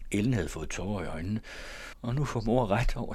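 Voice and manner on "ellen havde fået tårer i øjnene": plain reading voice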